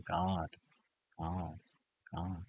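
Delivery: low, hushed single word repeated; fading into near silence; soft mantra repetition. Shown as a demonstration. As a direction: murmur it reverently, spaced by pauses. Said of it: "You can repeat".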